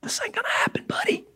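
Peter's private whisper, unvoiced. Peter pulling Jesus aside, whispering urgently. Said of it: "Peter takes Jesus aside and says".

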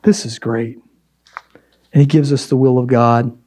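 whispered. Real, not acted. Whispered aside in sermon.

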